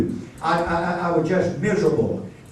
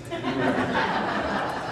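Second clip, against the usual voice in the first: soft breathy laugh; airy laugh; 6 semitones higher, 7 dB quieter than usual. Real, not acted. Preacher laughing at his own confession, soft breathy laugh. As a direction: let out a quick airless chuckle.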